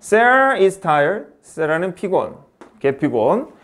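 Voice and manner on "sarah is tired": slow dictation. Shown as a demonstration. Teacher dictating example sentence.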